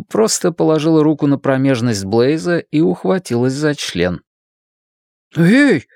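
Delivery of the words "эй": startled, higher and louder yelp; surprised exclamation. A narrator's reading. Blaise reacts to Ann's sudden grab, surprised. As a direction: cry out in surprise. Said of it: "в удивлении воскликнул он".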